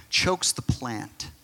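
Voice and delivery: ordinary talk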